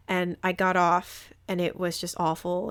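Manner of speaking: ordinary talk, nothing marked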